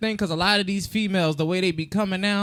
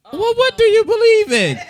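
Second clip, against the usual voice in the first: high probing question; 14 semitones higher, 8 dB louder than usual. Retold or imitated.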